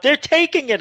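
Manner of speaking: high-pitched mock yell; retold mom yelling. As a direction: imitate an alarmed mother calling out, without full volume. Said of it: "I hear my mom yelling".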